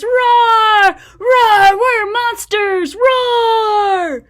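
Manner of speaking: loud, high shouted roaring; monster roars. Acted. Playing the wild things for a child, roaring.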